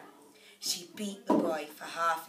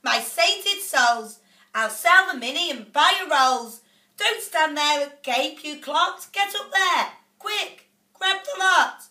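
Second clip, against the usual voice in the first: much higher and louder greedy shouting; mother ordering the boys up the tree; yelling out loud; 8 semitones higher, 9 dB louder than usual. A narrator's reading. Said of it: "she yells out loud".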